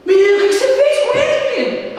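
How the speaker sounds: high, fast, exasperated scolding with a laugh; mother scolding, mock stressed. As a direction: play Mary fretting over the lost boy, half laughing. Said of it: "A mulher já fica estressada".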